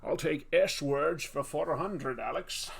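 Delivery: put-on slow, lisping Scottish accent; hammed-up Scottish quiz contestant; Sean Connery. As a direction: draw out slushy S sounds in mock Scottish. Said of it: "Well, I did my best".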